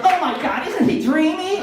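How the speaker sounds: high swooning fangirl voice, laughing; gushing fangirl. Retold or imitated. Comic mocking his date's Gosling crush, high gushing voice. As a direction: gush like a starstruck fan.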